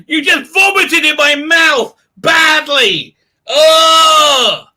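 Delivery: high, slow, disgusted shout; disgusted Americans. Retold or imitated. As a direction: recoil in mock horror, yelling loud. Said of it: "They're like".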